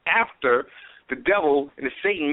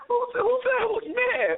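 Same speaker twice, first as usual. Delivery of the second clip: much higher, laughing protest; laughing denial. Real, not acted. Comedian laughs off being called mad.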